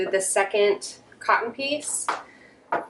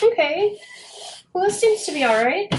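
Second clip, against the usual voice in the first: pleasantly surprised; 10 semitones higher than usual. Real, not acted. Checking the glued piece, relieved.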